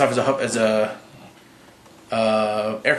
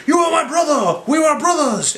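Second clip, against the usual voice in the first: very high hammed-up cry; mock pleading shout; 14 semitones higher than usual. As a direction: bellow it like an overwrought Thor. Voicing Thor pleading with Loki.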